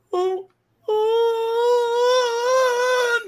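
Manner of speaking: very high, long held howl; mock girlish wail. Little girl shocked by boy's reveal, high mock wail.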